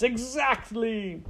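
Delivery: high, drawn-out gleeful cry; Botticelli. Acted. Rat gloats from the sidelines, gleeful and drawn-out. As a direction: stretch it out with gloating delight. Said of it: "Botticelli laughed from the sidelines".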